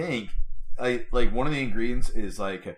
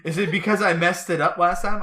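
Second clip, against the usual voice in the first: imitated wife, high and louder; higher, louder mock wife question; wife asking; 8 semitones higher, 5 dB louder than usual. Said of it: "She goes".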